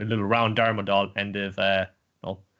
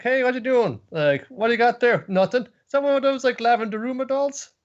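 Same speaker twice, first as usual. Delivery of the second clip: much higher, louder cartoon teen voices; pry at the bag; squeaky curious gang; nosy friends; 13 semitones higher, 5 dB louder than usual. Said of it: "It's like".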